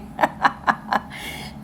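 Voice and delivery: short burst of breathy laughter; real laugh. Real, not acted. Reader laughs after a marriage prediction, short breathy laugh. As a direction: let a quick laugh escape. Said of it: "I'm not laughing".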